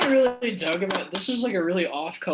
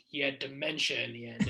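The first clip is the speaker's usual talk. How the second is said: monotone; 3 semitones lower, 5 dB quieter than usual. Retold or imitated. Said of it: "Larry King's like".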